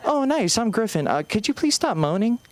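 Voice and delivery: high, chipper fake-friendly voice; sweet and bright, then slip in the ask; mock cheerful gym small talk; imagined overly friendly greeting. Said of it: "I'm like".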